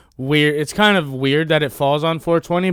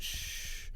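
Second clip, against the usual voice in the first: unvoiced hush; whispered shh; soft shush; 19 dB quieter than usual. Shown as a demonstration. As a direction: hush someone gently. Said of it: "I pictured myself after I went".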